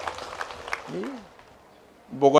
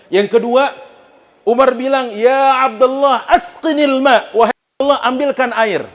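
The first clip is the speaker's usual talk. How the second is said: high, loud preaching build; rising sermon telling; 9 semitones higher, 5 dB louder than usual. Acted. Preacher tells the congregation about Umar, in a high, loud voice. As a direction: lift the story up with fervor.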